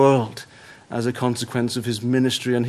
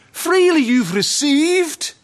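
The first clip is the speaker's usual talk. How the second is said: much higher, louder ringing call; proclaimed; 13 semitones higher, 5 dB louder, slower than usual. Acted. Christ charging his disciples, ringing proclaimed call. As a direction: cry it out to the hall.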